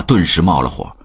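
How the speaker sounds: usual speaking voice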